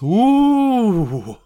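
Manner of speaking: high, drawn-out ghostly ooh; mock-spooky wail. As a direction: tease with a long haunted-house ooh. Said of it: "Hot dog guy makes spooky sounds".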